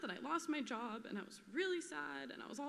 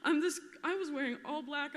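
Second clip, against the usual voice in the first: laughing; 4 semitones higher, 6 dB louder than usual. Real, not acted. Laughing while recalling clown visit.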